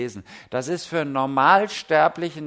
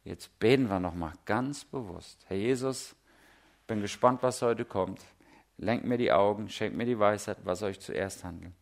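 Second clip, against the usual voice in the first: quiet prayer; 6 semitones lower, 5 dB quieter than usual. Retold or imitated. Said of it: "komme ich auf die Idee und sage".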